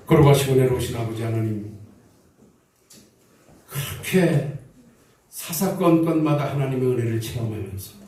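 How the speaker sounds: low, measured prayer voice; pastoral prayer. Real, not acted. Pastor leading the congregation in closing prayer, low and measured. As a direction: pray steadily on the church's behalf.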